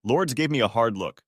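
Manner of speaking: plain reading voice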